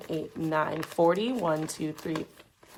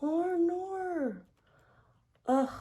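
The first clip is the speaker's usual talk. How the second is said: put-on terrible British accent; 8 semitones higher, slower than usual. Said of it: "I was rambling about a British accent".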